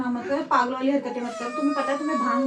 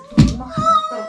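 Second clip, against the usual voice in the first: higher, louder shout; angry yell; 8 dB louder than usual. Real, not acted. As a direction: snap at him loudly. Wife yelling at husband.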